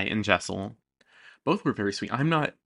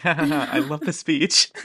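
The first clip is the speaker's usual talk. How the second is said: mock laugh; 6 semitones higher, 7 dB louder than usual. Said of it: "And she was like".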